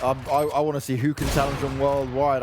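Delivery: normal talking voice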